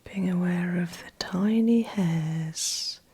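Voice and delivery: soft, breathy meditation voice with long pauses; guided meditation. Acted. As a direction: guide them gently back, phrase by phrase, leaving space. Guides client back into body.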